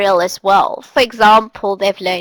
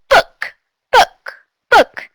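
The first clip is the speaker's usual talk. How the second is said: much higher squeaky puppet voice; panda puppet voice; 12 semitones higher, 4 dB louder, slower than usual. Shown as a demonstration. The panda puppet repeating a word for the student, much higher. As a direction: make the puppet chirp the word. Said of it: "Panda, go".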